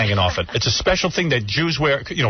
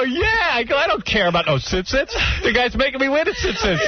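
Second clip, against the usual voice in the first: put-on defiant kid; 10 semitones higher than usual. Boy talks back to his aunt.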